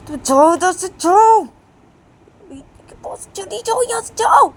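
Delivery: high, loud yelling away; furious mother driving her off; angry shout. Acted. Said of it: "P बोलते रही".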